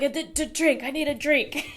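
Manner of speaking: mock gasping stutter with a laugh; imitating being winded. Reviewer mimics her own breathless drink plea, laughing. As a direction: replay your own breathless stammer, laughing. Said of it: "I was like".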